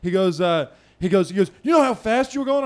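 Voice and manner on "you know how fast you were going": high, fast mock interrogation; heated cop. Cop confronting the speeding driver, high fast heated demand. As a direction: fire it off as an angry cop. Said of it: "he comes up hot".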